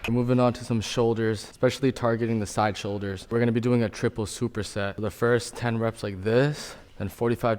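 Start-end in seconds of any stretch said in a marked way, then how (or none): none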